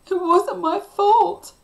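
loud, high, drawn-out wail; sobbed protest. A narrator's reading. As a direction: wail your innocence like an upset child. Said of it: "Cliff sobbed".

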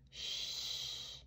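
long, soft shush. A narrator's reading. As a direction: hush noisy children. Mother owl hushes noisy children.

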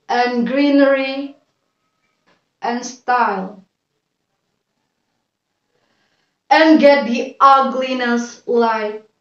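slow, declaimed poem with long pauses; poem recitation. Acted. Student reciting a poem for a video, measured declamation. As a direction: recite it line by line, deliberately and clearly.

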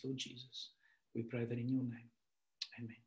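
ordinary talk, nothing marked

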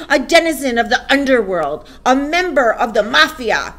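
loud, high, disgusted tirade; booming denunciation. A narrator's reading. Headmistress denounces a boy before the school, booming. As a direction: pile up insults with booming relish. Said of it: "boomed the headmistress".

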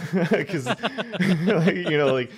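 high laughing through the words. Real, not acted. Laughing at own rawness.

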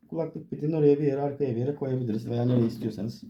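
ordinary talk

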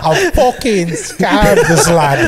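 slow, laughing mock British accent; super British. Mocking Aussie slang with a laughing British accent. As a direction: ham up a British bloke through laughter. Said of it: "but goes super British".

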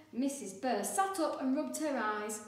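plain reading voice

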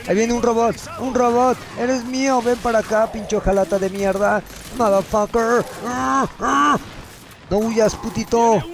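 high, gleeful taunts at the enemy; high mocking trash talk; taunting glee. Real, not acted. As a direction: bait the robot, thrilled.

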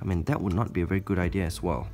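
ordinary talk

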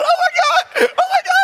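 hysterical panic. Retold or imitated. Pastor on the bed fleeing a roach, high laughing shrieks.

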